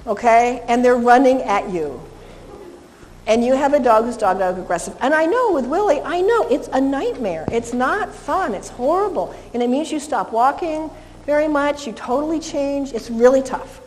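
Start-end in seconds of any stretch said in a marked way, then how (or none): none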